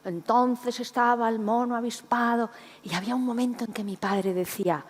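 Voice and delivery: ordinary talk, nothing marked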